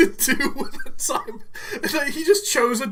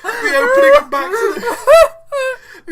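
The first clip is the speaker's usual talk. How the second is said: high, loud squeal through laughter; words collapsing into real laughter; laughing hard; 13 semitones higher, 9 dB louder than usual. Real, not acted. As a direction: try to speak while helplessly cracking up. Helpless laughter while explaining.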